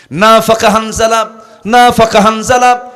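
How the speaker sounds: loud, high chanted cry; retold crying out. Companion runs to the Prophet confessing hypocrisy, chanted cry. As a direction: cry it out repeatedly, imitating the alarmed companion. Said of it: "চিৎকার মেরে মেরে".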